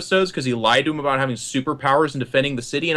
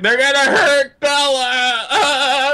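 high, slow hammed-up sobbing plea; crying wail; 7 semitones higher, 4 dB louder, much slower than usual. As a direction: wail it in panic. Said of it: "he's like".

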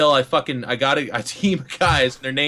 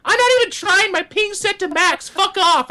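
put-on very high, squeaky kid whine; defensive nine-year-old gamer; 4 dB louder than usual. Child excusing his bad score, squeaky mock tantrum. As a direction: imitate a bratty child snapping back. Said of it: "He's like".

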